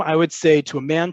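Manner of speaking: ordinary talk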